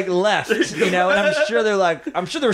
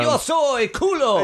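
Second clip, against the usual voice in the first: high, very slow declaration; mock grand announcement; 9 semitones higher, slower than usual. Comic pretending to greet locals in Spanish, mock proclamation. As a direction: draw it out in a silly high voice.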